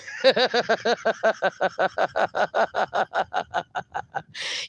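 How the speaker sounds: long, even run of chuckles; helpless extended giggling at a joke; long laugh. Real, not acted. Amused by Spanglish taco order.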